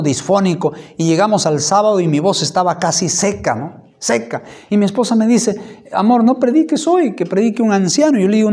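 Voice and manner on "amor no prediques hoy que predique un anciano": higher, louder, imitating his wife's plea; mock the wife begging him to rest; husband retelling his wife's plea, raised and louder; pleading wife. Wife pleads he not preach.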